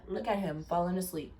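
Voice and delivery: usual speaking voice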